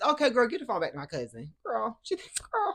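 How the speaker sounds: ordinary talk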